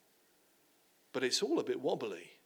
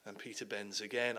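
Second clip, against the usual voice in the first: normal talking voice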